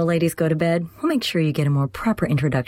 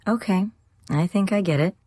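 serious, thoughtful; 11 semitones higher than usual. A narrator's reading. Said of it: "Her expression was serious and thoughtful".

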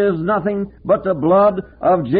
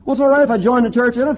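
quick, higher voice of a self-assured churchgoer; mock objector, quick; 8 semitones higher, faster than usual. Preacher mimics a churchgoer excusing himself, quick and higher. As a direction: rattle it off like a smug excuse. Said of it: "You say".

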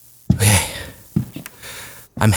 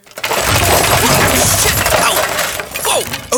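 high frightened yelps; panicked yelps; 13 semitones higher, much slower than usual. Acted. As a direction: yelp as things fly at you. Attacked by flying luggage.

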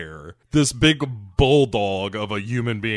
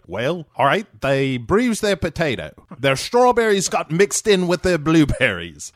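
mock Australian doctor; 5 semitones higher than usual. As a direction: lay the Aussie accent on thick. Kidman voiced in mocking Australian.

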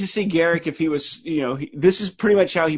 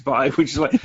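ordinary delivery

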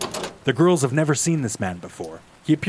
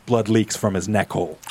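ordinary delivery, nothing marked

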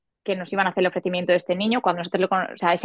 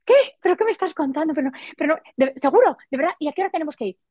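higher, half-shouted disbelief, rushing; excited shouting; 7 semitones higher, faster than usual. Retold or imitated. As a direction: blurt it out, thrilled and stunned at the news. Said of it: "Yo ya empiezo a gritar". (gritando emocionada, más aguda)

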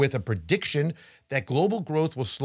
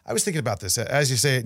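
usual speaking voice

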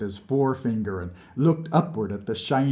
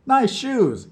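much higher, louder admiring cry; 13 semitones higher, 6 dB louder than usual. A narrator's reading. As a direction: gush over the shoes. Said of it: "Vlad said admiringly".

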